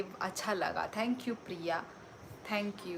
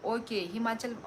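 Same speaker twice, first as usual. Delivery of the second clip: usual speaking voice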